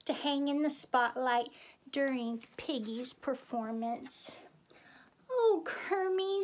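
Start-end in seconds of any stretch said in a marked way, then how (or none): 5.3-6.4 high, cooing delight; touched diva. A narrator's reading. Miss Piggy touched by Kermit's gift, cooing. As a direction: coo it at a higher pitch.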